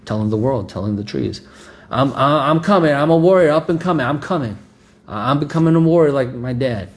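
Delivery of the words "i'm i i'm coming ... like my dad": high, loud burst of eagerness; eager child boasting. Acted. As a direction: proclaim it excitedly as an aspiring young warrior. Voicing a child keen to become warrior.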